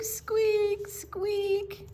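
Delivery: high squeaking vane sound; high creaky squeak; squeaky weather vane. A narrator's reading.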